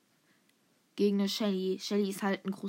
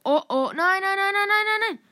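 high, loud string of rapid noes; panicked yelling; 10 semitones higher, 8 dB louder than usual. Real, not acted. Gamer about to die in the match, panicked yelling. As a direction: yell it in real panic.

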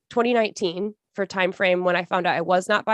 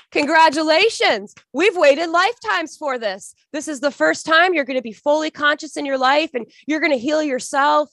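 celebrating spirit beings; 7 semitones higher, 4 dB louder than usual. Retold or imitated. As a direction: announce it like a chorus of beings welcoming her. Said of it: "they're clapping and they go".

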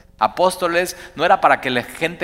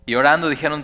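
usual speaking voice

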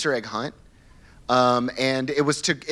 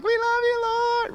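mock-swooning falsetto, much higher; mock worship; slower than usual. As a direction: ham up a teary camper's praise in falsetto. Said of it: "with their candles being like".

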